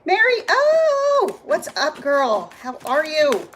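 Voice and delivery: high, loud, drawn-out hello; thrilled greeting for a viewer who just joined; excited greeting. Real, not acted. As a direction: stretch her name out in delight. Greeting a viewer joining the live.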